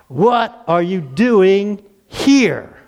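very slow, emphatic divine question; God questioning Elijah. Acted. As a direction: draw out each word, confronting Elijah as God. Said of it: "what does God say to him now".

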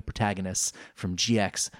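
ordinary delivery, nothing marked

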